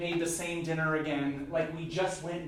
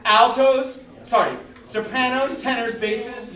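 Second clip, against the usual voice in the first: loud cue to the singers; calling out sections; 8 semitones higher, 13 dB louder, slower than usual. Real, not acted. Conductor calling the sections loudly over the choir. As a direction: call each section in. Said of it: "your job is to live".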